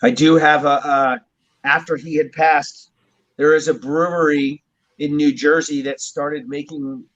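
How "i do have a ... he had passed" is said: usual speaking voice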